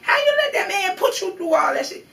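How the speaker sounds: high-pitched mock outrage, quoting people; shrill-high, loud imitation of disbelief; incredulous askers. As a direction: imitate appalled people demanding an answer. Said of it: "people would ask me".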